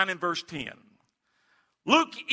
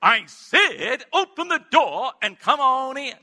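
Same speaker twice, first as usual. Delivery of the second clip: little man's voice pitched higher, insistent; 5 semitones higher than usual. Retold or imitated. Man behind the door insisting the preacher come in, high-pitched. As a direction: repeat the invitation, squeaky and pushy. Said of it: "the little high-pitched man's voice".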